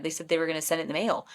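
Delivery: usual speaking voice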